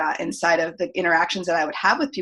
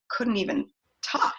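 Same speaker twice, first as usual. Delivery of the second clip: whisper; 4 semitones higher, 5 dB quieter than usual. Real, not acted. Lost voice, can't talk.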